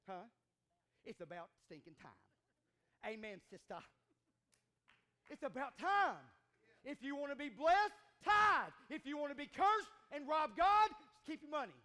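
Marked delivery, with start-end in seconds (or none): 5.3-11.8 loud, hammering preacher cadence; full-force preaching. Acted. Preacher urging the church to tithe, full-force preaching. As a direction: hit each line like a challenge.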